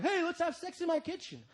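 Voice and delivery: high, soft, eager asking; normal-guy voice. Acted. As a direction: ask it high and light, uncool. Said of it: "can't ask her in your normal voice".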